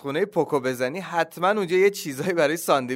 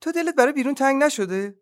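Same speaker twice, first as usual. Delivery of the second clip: high-pitched tentative mouse question; little mouse; 11 semitones higher than usual. A narrator's reading. Mouse asking duck about missing outside, high little voice.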